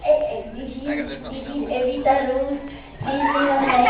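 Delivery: put-on slow grandma with Mexican accent. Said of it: "only if I did a Mexican accent".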